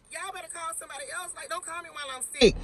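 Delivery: high, fast, tossed-off brush-off; sassy dismissal. Real, not acted. Venting at management, a high fast sassy brush-off. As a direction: wave them off quickly.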